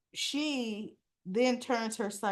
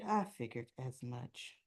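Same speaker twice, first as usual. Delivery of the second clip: quiet resigned reply; 7 semitones lower, 8 dB quieter than usual. Retold or imitated. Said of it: "And Anna said".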